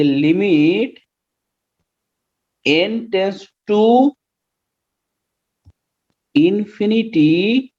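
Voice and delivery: very slow, drawn-out words with pauses; slow dictation. Real, not acted. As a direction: spell out the formula as you write. Writing the formula while saying it. (लिखते हुए बहुत धीरे बोलना)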